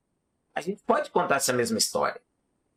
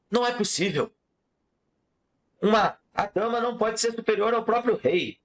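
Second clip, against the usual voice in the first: indignant king; 9 semitones higher than usual. Acted. King objecting in story.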